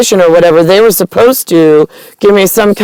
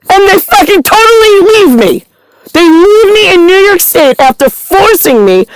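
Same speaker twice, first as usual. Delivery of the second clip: angry rant; 9 semitones higher than usual. Real, not acted. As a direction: pour out the anger until the voice cracks into crying. Ranting to politician, building to tears.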